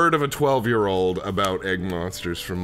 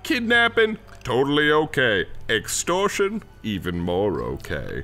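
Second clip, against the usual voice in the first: gloating villain; 4 semitones higher than usual. Acted. Doctor tells Dana there is no law, grandiose villain voice.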